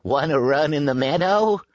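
high-pitched sheep character voice; bleating sheep. A narrator's reading. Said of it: "bleated the sheep".

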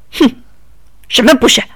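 rough retort. A narrator's reading. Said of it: "大盗贼粗暴地说".

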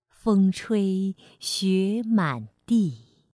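slow, measured classical verse reading; poem recitation. Acted. Teacher reads an old poem for children, slow recitation. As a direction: stretch each phrase, pausing between lines.

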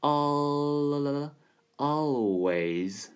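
always broken up and drawn out very slowly; al-, then always, slow and careful; slow demo. Shown as a demonstration. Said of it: "舌尖翘起来".

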